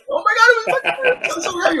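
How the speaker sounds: very high, mock-excited raving; imitated starstruck squeal; gushing fan. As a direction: gush breakneck-eager in a high fan voice. Fans gushing about the song.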